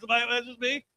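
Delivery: ordinary delivery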